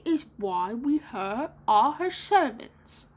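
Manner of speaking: slow put-on boy voice; lost-boy voice, stretched; Curly explaining. A narrator's reading. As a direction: explain it in drawn-out words. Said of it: "explained Curly".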